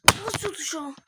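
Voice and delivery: frustrated yell. Real, not acted. Player yelling at the unresponsive button, frustrated shout. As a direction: snap at the controller in frustration.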